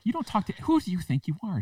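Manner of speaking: high-pitched indignant retort; manager telling customer off. Retold or imitated. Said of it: "And she's like".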